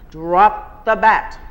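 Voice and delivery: loud, slow bossy bark of a gym teacher; shouted order. A narrator's reading. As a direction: yell it right into his ear. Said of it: "the voice of Missus Rourke shouts".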